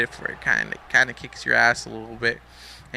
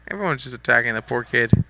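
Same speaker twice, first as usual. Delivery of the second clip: ordinary talk, nothing marked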